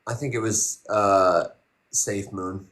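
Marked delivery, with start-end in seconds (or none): none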